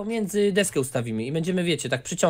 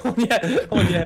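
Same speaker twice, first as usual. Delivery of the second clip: laughing; 8 semitones higher, 3 dB louder than usual. Real, not acted. Laughing at falling dog.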